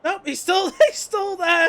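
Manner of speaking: laughing, high, loud race call; motorsport commentator. Real, not acted. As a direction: yell it in delighted disbelief. Live call of surviving spin.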